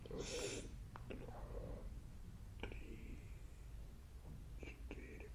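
soft breathy whisper; whispered counting. Real, not acted. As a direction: whisper the count during the hold. Counting during breath hold.

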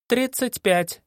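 high, perky answer; cheerful lie. Acted. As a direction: lie brightly. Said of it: "бодро соврала Саша".

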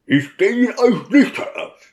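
high-pitched, strained outcry of defiance; deacon's high, indignant protest; outraged cry. A narrator's reading. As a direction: cry out in indignation, pitch far up, without full volume.

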